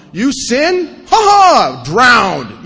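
booming high, gleeful mock wrath with a cackle; vengeful God. Speaker mocking his childhood image of God, loud gleeful mock wrath. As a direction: play a smug thundering deity punishing sinners. Acting the Old Testament God.

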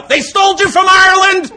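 high, loud shouted taunt; hammed-up shout; mock yell. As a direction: yell it out at the passing redheads. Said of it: "I wanna yell".

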